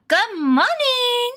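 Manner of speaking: very high, slow, stretched GOOD MORNING; long GOOD rising into a bright-eyed high MORNING; excited morning greeting. Pretend. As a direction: sing out a drawn-out, high greeting. Performs the drawn-out greeting for viewer.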